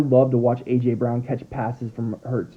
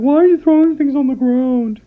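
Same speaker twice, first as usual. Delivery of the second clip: high, loud put-on dog voice; dog's voice; 12 semitones higher, 5 dB louder than usual. Owner voices his dog asking why things fall, in a high loud put-on dog voice. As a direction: squeak it out as the puzzled pup. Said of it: "He's like".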